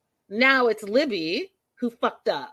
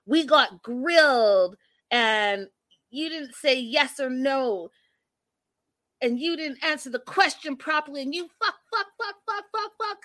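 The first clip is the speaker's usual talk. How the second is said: mock furious rant, climbing higher until the curses squeak; angry boyfriend impression; 6 semitones higher than usual. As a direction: act out his tantrum, winding up into a pitched fuck fuck fuck. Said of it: "And then he's like".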